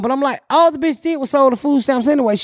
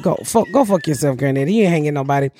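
put-on lower, dismissive kid retort; low defiant kids; 9 semitones lower, faster than usual. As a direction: brush granddad off, low and unimpressed. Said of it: "We like".

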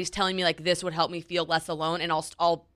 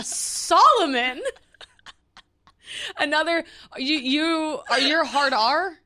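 "Solomon" said slowly and higher through laughter, SAH-luh-mun; laughing disbelief; 7 semitones higher, slower than usual. Retold or imitated. Retelling her lunch reaction, laughing "Solomon?". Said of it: "I was like".

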